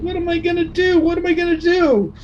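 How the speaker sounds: very high, wailing cry. A narrator's reading. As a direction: wail it out in tears. Wilbur under covers.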